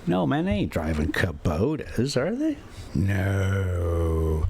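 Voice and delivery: usual speaking voice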